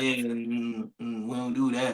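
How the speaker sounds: quiet, low head-shake hum; low disapproving murmur. Real, not acted. Reacting to cousins dating, a low disapproving murmur. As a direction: grumble no under your breath.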